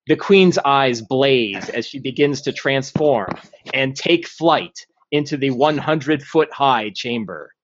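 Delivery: ordinary delivery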